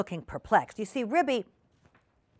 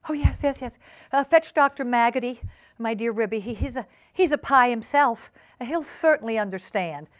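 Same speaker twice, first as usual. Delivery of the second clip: higher, stammering urgency; agitated invalid Duchess; 5 semitones higher, 3 dB louder than usual. Acted. Duchess begging Ribby for the doctor, agitated. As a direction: plead urgently, tripping over words.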